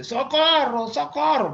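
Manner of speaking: loud, very high acted yell of a name; comic mother's shout, high and forceful; mother calling daughter. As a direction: holler for someone in another room. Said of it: "era la mamá que decía a la hija".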